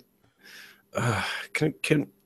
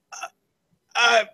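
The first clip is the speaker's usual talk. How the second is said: nervous cop; 9 semitones higher than usual. Retold or imitated. Player voices a nervous beat cop.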